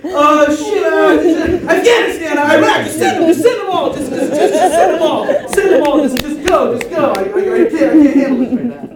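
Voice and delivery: high loud frantic laughing rant; panicked Bush. Retold or imitated. George Bush panicking over the ticking device, laughing shout. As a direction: flail through the orders in a laughing panic. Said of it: "And he's like".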